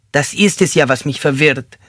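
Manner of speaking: high-pitched, confused whine; whimpering. Acted. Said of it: "wimmerte er".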